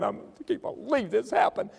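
high, moaning disbelief, hammed up; mock lament, high and whiny; mock wailing. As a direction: wail it like a sore loser. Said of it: "crying and saying".

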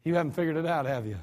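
low, soft correction; gentle rebuke. Acted. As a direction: quietly tell her she hasn't understood. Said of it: "And Jesus said".

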